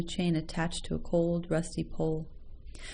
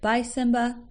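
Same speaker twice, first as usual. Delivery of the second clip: a little higher and louder, tender and simple; simple, loving goodbye; 6 semitones higher, 5 dB louder than usual. A narrator's reading. Teenager saying goodbye to his dog, lovingly. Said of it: "said simply but lovingly".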